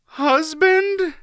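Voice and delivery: put-on a terrified boy repeating the word; panicked high quaver. A narrator's reading. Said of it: "Garion quavered again".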